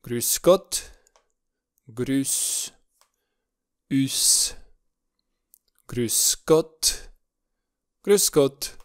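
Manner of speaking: Grüß with rounded ü, lips pushed forward; German Grüß Gott. Shown as a demonstration. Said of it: "Acesta este un Ü". (Grüß cu ü rotunjit, buzele înainte)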